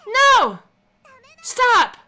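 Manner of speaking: high, very loud pleading shouts; desperate cry. A narrator's reading. Rika shouting to protect Satoko. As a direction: cry out to stop her.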